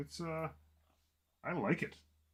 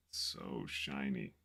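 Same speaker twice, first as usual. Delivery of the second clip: dreamy drawn-out shiny; 7 semitones lower, much slower than usual. Real, not acted. Tempted by shiny paint.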